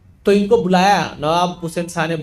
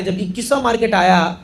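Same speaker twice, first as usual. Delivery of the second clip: ordinary talk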